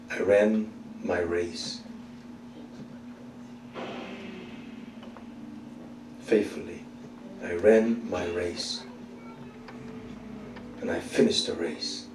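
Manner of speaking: slow, low and hushed; solemn prayer. Shown as a demonstration. Believer telling the Lord at death, solemn prayer. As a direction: pray it softly with long pauses.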